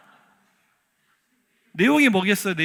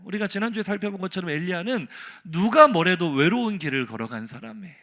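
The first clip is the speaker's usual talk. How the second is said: ordinary delivery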